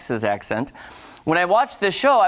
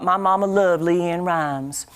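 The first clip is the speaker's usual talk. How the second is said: slow, imitated Southern drawl; Southern accent; slower than usual. Host imitating the show's characters, in a drawn-out Southern accent. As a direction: stretch it out in a mock Southern twang. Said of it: "just start talking like that".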